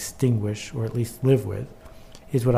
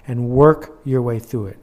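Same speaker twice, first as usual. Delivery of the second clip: "work" hit louder than the rest; stress on work; 5 dB louder than usual. Real, not acted. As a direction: lean hard on the word "work". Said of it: "Emphasis on the word work".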